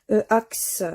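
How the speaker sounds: usual speaking voice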